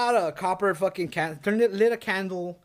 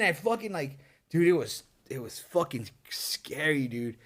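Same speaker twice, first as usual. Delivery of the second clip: ordinary delivery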